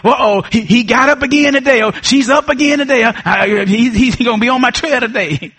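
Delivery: a hint of a nervous devil; mock devil. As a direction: play the devil fretting that you got up again. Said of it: "when the devil says".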